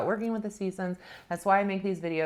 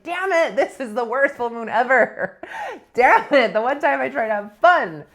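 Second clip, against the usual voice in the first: exasperated, hammed-up inner grumble, higher and louder; negative Nancy; 4 semitones higher, 6 dB louder than usual. Her negative self cursing the full moon on being pulled over, exasperated. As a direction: whine loudly about your ruined night. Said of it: "my negative Nancy self was like".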